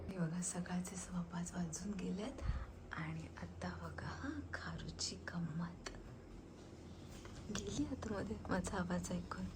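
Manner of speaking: soft whisper so the pet keeps sleeping; whispering so the pet won't hear. Real, not acted. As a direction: hush it, barely breathing. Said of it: "म्हणून मी हळू बोलत होते".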